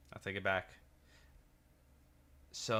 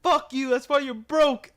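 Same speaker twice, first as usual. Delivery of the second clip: much higher, louder, slower yell; retold shouted insult; 15 dB louder than usual. Friend yells at the drive-thru window, loud and high. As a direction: bellow it as you drive off. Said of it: "He's like".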